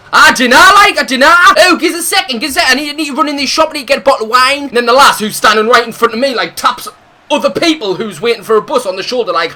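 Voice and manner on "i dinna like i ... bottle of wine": louder, higher hammed-up drunk mate voice. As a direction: play the pushy drunk mate begging for a second. Mate asking to run into shop.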